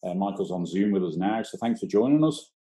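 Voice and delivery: usual speaking voice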